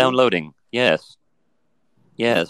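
synthetic robot voice; chatbot. Acted. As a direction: speak like a polite, literal machine. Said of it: "run program love".

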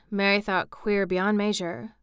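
usual speaking voice

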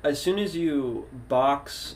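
usual speaking voice

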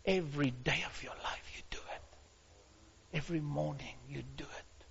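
soft hushed whisper. Real, not acted. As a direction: drop to a secret whisper. Sudden hush after shouting.

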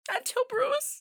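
high, soft tearful break; tearful wife. Acted. Wife recalling her missing husband, tearful and high.

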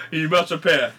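put-on lightly done Ghanaian accent of a mother giving advice. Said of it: "Say it in your accent".

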